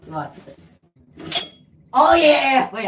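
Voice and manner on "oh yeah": loud, high delighted cry; excited shout. Real, not acted. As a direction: yell with sudden glee. Thing in bathroom surprises.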